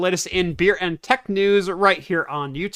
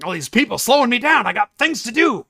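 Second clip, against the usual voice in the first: louder, higher mock road-rage grumble; impatient driver; 6 semitones higher, 5 dB louder than usual. Mocking the guy who hates ads as a pushy driver, louder and higher. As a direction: play the selfish driver fed up with traffic.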